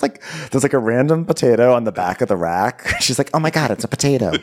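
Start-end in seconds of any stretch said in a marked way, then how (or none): 3.3-4.3 quick, higher mock-alarmed exclamation. Cook finding a potato on the rack, mock alarm. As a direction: blurt it out in playful alarm. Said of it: "They're, like, alarmed".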